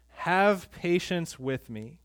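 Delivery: slow, measured reading of the plea; slow scripture reading. Acted. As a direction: read the verse slowly and evenly. Reading the servant's plea aloud.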